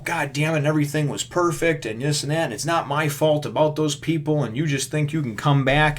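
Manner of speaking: hammed-up ranting at his friend; mock yelling rant. Shane ranting at Rick over the gun, mock yelling. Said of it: "hooting and hollering and yelling".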